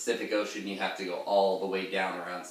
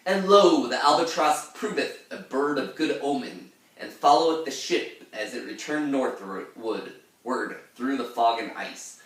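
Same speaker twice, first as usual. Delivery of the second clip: put-on raised, solemn old-text reading; archaic reading; 5 semitones higher, 5 dB louder than usual. Shown as a demonstration. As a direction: read it like scripture, raised up. Reading the archaic gloss aloud.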